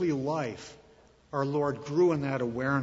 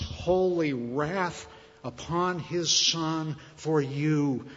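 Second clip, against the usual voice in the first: ordinary talk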